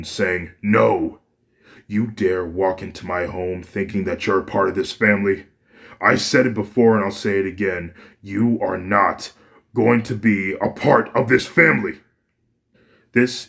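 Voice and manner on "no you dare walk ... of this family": louder furious father refusing; angry tone. Acted. Father rejects his returned son from the family, louder and furious. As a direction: build from a sharp no to a shouted rejection. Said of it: "anger in his tone".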